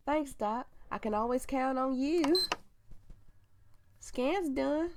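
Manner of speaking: put-on high, butchered country nurse accent. Nurse thanking Doc as the scan finishes, country accent. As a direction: pitch it up into a hammed country twang. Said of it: "that accent actually exists that I'm butchering".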